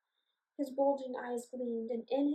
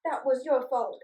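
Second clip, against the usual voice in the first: complaining; 7 dB louder than usual. Acted. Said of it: "complained one of the goblins".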